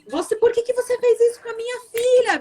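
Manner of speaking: bem mais agudo, pai furioso, levemente imitado; grito bravo imitado. Retold or imitated. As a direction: cobre como um pai irado, sem gritar de verdade. Father confronting, beating someone. (pai bravo cobrando, em tom de grito imitado)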